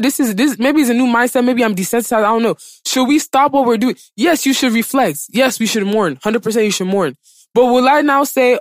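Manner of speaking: usual speaking voice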